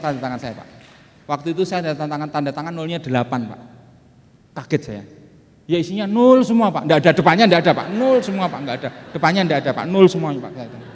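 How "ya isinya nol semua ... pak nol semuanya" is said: excited exclaiming. Retold or imitated. Excited about an empty contract.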